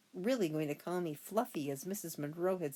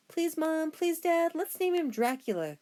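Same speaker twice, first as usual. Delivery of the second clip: put-on high, loud pleading kid; a boy crying out to his parents to keep the name Dracula; begging cry; 11 semitones higher, 7 dB louder than usual. A narrator's reading. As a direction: beg Mom and Dad at the top of your voice. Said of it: "cried Toby".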